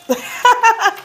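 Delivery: short bright laugh, falling; high laugh. Real, not acted. Laughs at the card result.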